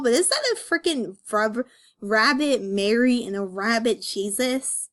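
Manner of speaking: ordinary talk, nothing marked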